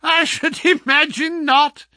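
high, loud, laughing retort; scornful laugh. A narrator's reading. Visitor scoffs at the sick man, laughing. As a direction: laugh the words out at him. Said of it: "The other laughed".

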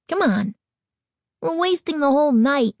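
put-on boyish complaint rising in pitch; kid's impatient grumble; complaining. A narrator's reading. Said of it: "his brother complained".

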